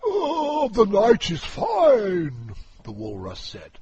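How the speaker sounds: high, loud, booming walrus; a pompous walrus declaiming loudly. Acted. Walrus voiced.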